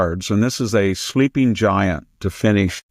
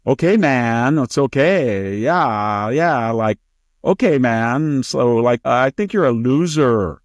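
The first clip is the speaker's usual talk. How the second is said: put-on drawn-out Valley Girl accent, pitched up; Fang Fang; 4 semitones higher, slower than usual. Interviewer dropping the act, Valley Girl accent. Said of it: "she went into her Valley Girl".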